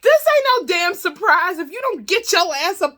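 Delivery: high, loud indignant squawk; exasperated eviction. Retold or imitated. Host ordering snoring man to leave, exasperated eviction. Acted outburst ordering him out.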